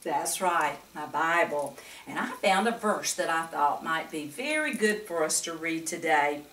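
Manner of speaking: ordinary delivery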